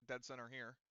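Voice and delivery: ordinary delivery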